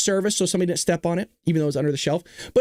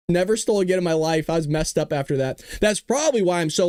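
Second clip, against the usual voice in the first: ordinary delivery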